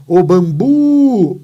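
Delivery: loud hailing, BU of "bambu" held on and on; stretched stressed syllable. Shown as a demonstration. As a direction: call out "bambu" with BU very loud and long. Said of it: "olha a minha sílaba forte aqui".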